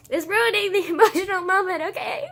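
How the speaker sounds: high, loud protest breaking into a laugh; laughing complaint. Real, not acted. Tearful vlogger gripes about a ruined emotional moment, laughing complaint. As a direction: laugh while loudly complaining.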